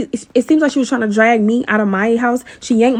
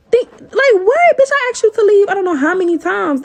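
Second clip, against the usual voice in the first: indignant outburst; 8 semitones higher than usual. Retold or imitated. Vlogger reliving the fight, yelling at the neighbor in disbelief. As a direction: let the outrage burst up high. Heated rant at intruder.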